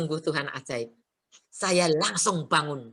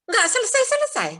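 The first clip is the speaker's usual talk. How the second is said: high, exasperated whine on "Nggak selesai-selesai"; fed-up high wail; 5 dB louder than usual. Retold or imitated. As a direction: squeal "Nggak selesai-selesai" high and louder in mock despair. Complaining about endless troubles.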